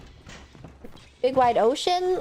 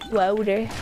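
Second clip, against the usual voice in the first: ordinary talk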